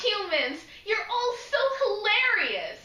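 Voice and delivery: high, bright quoted cheer; a hint of gushing delight; lightly voiced Rose. Quoting Rose.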